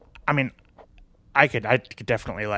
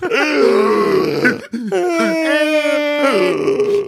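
put-on toddler gasps; 14 semitones higher, 6 dB louder than usual. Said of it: "doing the big toddler gasps".

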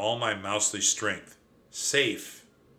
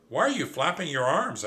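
mildly shouted, higher pitch; exasperated question with raised pitch; 6 semitones higher, 3 dB louder than usual. A narrator's reading. Mouse watching his cousin flail, shouting mildly. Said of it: "I shouted at him".

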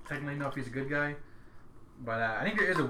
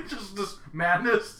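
laughing; 7 semitones higher, 3 dB louder, much slower than usual. Real, not acted. Laughing at a trippy show.

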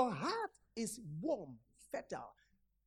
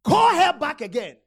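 loud summoning call; urgent command; 5 semitones higher, 15 dB louder than usual. Acted. Elisha tells Gehazi loudly to call her. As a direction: call her back now.

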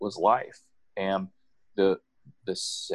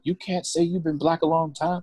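high-pitched imitation of an angry mother; playfully imitated maternal telling-off; mock scolding mom; 9 semitones higher than usual. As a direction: scold in a raised pitch, not truly yelling. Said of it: "she yelled at me. Oh, she was mad".

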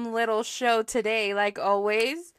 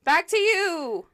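radio host voice; 7 semitones higher, 8 dB louder, much slower than usual. Pretend. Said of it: "We should be radio talk show hosts".

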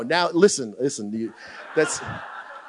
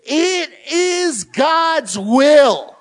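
slow, loud, higher proclamation; preached shout; 6 semitones higher, 5 dB louder, much slower than usual. Shown as a demonstration. Pastor demonstrating how he reads scripture, slow loud shout. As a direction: drag each word out and hammer it. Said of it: "it says this".

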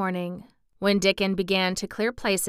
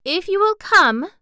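put-on high, loud girlish eagerness; eager plea; 12 semitones higher, 9 dB louder than usual. Mary wanting him to come, eager. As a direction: implore him, pitched right up.

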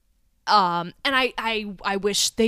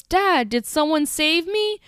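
a hint of a small boy, higher and slow; tiny child voice; 7 semitones higher, slower than usual. Retelling the son's line to co-host, lightly voiced tiny child. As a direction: lightly voice a tiny sick kid. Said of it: "tiny, tiny little baby asthma voice".

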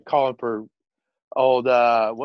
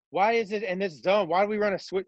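normal talking voice

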